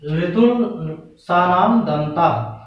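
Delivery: very slow, each word drawn out; slow recited sutra. Shown as a demonstration. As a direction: spell the rule out slowly for the class. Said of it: "इसी प्रकार है". (सूत्र को बहुत धीरे खींचकर पढ़ना)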